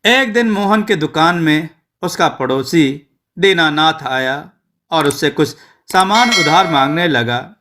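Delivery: plain reading voice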